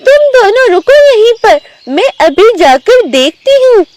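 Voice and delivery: high-pitched pig character; mother pig instructing. Acted. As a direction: tell the cubs to wait, pitched high. मादा सुअर बच्चों को रोकती है. (ऊँची आवाज़ में मादा सुअर)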